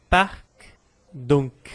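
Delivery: "parc", "donc", each closed on an audible k; two separate words, sound the final k of "parc" and "donc"; C final prononcé. Shown as a demonstration. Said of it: "eu também pronuncio".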